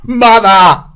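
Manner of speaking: high, loud cry for help; frightened shout. A narrator's reading. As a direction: scream for your mother under fire. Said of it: "Hegswell shouted and shrank down".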